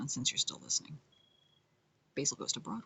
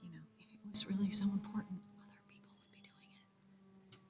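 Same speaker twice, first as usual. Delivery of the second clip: hushed, suspicious asides; whispered doubt; 5 semitones higher, 3 dB quieter than usual. Acted. Questioning the village rules, in a whisper. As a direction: whisper it like a conspiracy.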